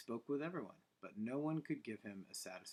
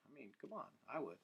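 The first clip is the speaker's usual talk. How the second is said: plain reading voice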